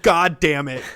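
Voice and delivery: loud imitated hero's curse; mock frustration. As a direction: curse like a cartoonish game hero. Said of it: "he'd be like".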